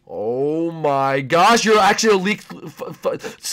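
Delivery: ordinary delivery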